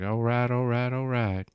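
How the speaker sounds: mock laid-back Texan catchphrase; Matthew McConaughey impression. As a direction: roll the all rights out like a chilled-out Texan actor. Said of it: "like Matthew McConaughey".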